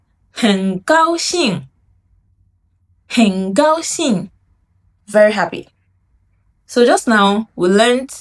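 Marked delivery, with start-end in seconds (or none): 0.1-4.3 很高兴 said slowly with each tone clear; slow model. Shown as a demonstration. Teacher modelling 很高兴 slowly for students. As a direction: lay out 很高兴 slowly for the class. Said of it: "You say".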